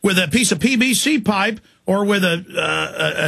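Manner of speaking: ordinary talk, nothing marked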